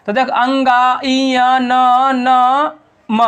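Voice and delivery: अंगा, इय, न, न, म drawn out through the nose; nasal sounds demo. Shown as a demonstration. Teacher demonstrating the five nasal letters to students, nasal drawn-out sounds. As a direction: hum each of अंगा, इय, न, न, म nasally. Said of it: "नाक से बोल रहा है ना".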